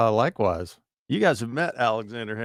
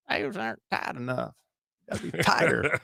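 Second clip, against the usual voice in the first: put-on high girly voice; imitating the salesgirl; 7 semitones higher than usual. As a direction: play the pushy shop girl. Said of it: "the girl's like".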